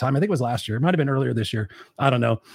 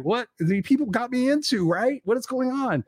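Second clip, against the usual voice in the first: bewildered; 9 semitones higher than usual. Retold or imitated. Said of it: "So I was like".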